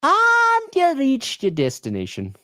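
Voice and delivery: slow, high sat-nav announcement; GPS voice. Pretend. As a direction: sound like a navigation voice. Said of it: "That was beautiful".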